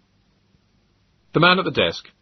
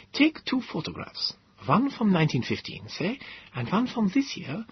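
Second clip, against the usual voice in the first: earnest professor; 10 semitones higher, 3 dB quieter than usual. Acted. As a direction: explain the proof intently. Gödel character voice.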